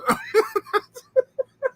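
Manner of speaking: high breathy laugh; squealing laugh. Real, not acted. Laughing at himself over the story, high squeal.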